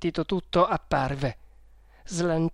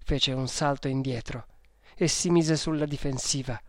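ordinary delivery

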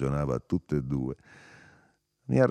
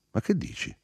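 bewildered questioning. A narrator's reading. Quantorzo questions the narrator, bewildered. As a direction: ask in dismay, stretching the second question. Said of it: "domandò Quantorzo".

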